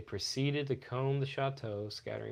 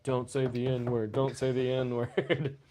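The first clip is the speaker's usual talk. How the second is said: slow, deliberate self-warning; slow anxious inner voice; 4 dB louder, slower than usual. Retold or imitated. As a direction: repeat it slowly to yourself. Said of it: "the whole time I'm thinking".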